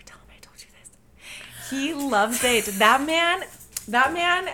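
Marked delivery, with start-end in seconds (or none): 0.1-1.7 secretive whisper; conspiratorial whisper, sharing a secret. Real, not acted.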